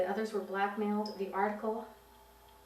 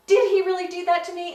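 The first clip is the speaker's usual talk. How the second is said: outraged cry; 10 semitones higher, 13 dB louder than usual. Pretend. Imagined normal mother's outraged cry.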